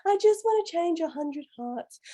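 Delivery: mock a dreamy pageant answer; Miss Universe contestant. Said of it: "not talking a Miss Universe".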